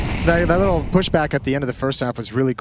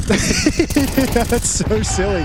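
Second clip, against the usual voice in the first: high, slow, giggly amusement; 6 semitones higher, much slower than usual. Real, not acted. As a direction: say it through a giggle. Giggling at the silly play.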